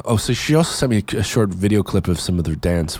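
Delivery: ordinary talk, nothing marked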